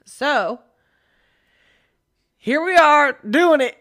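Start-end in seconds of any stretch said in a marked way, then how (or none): 2.4-3.8 mock show intro. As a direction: ham it up like an over-the-top host.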